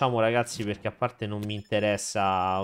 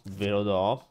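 talking while burping; slower than usual. Real, not acted. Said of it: "Scusate, ho ruttato".

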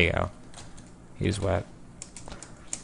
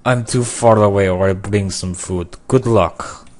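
loud, put-on Russian accent; 7 dB louder than usual. Gamer typing to another player for food, mock Russian accent. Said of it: "I don't know why I did Russian accents".

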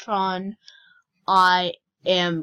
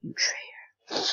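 mock whisper; 5 dB quieter, slower than usual. Joking insult to player Iran.

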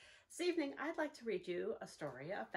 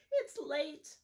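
mouse squeak; 5 semitones higher, 6 dB louder than usual. Acted. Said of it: "Mouse squeaks".

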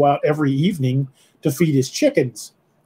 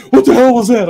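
startled shout; 8 semitones higher, 7 dB louder than usual. Retold or imitated. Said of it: "they're like".

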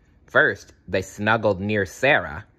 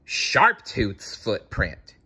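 ordinary delivery, nothing marked

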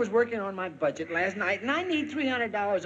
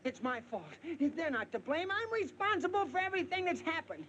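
frantic pleading; 7 semitones higher, 7 dB quieter than usual. Acted. Frantic husband takes the blame.

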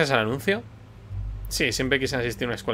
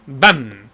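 loud spoken bam for a crash; mock slam noise; 9 dB louder than usual. Game master voicing a loud slam effect. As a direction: punch out the sound effect.

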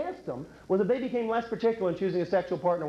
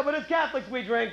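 ordinary delivery

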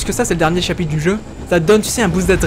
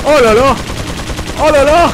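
loud high exclamations; excited outcry; 7 semitones higher, 4 dB louder than usual. Real, not acted. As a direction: cry out in alarm. Frantic gunfight.

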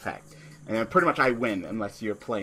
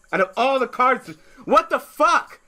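frustrated rant; 13 semitones higher, 6 dB louder than usual. Real, not acted. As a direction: shriek your disbelief at the game. Key card shuffled away, raging.

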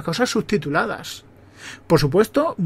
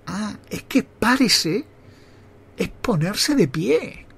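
slow, higher, drawn-out mock discovery; dawning realisation; 3 semitones higher, much slower than usual. Boy learning what the shop lady meant, slow realisation. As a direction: stretch it out as the penny drops. Acting out his childhood realisation.

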